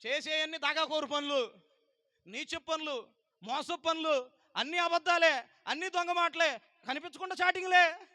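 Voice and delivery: loud, rising denunciation, each phrase hammered; fiery preaching. Acted. Preacher denouncing the congregation's lies, in a loud fiery preach. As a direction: rail at the sinners from the pulpit.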